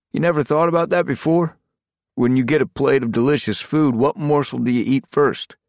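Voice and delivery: high, loud playful explaining voice; teasing a newcomer, light and friendly; amused leader. Acted. Morvant explains the game.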